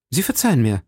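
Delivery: incredulous exclamation; exclaiming. A narrator's reading. D'Artagnan to the stranger who forgives him, exclaiming. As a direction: exclaim it in mild astonishment. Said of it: "rief D'Artagnan".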